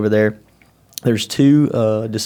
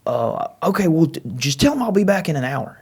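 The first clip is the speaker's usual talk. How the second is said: voicing the brother; 7 semitones higher than usual. Brother on speakerphone.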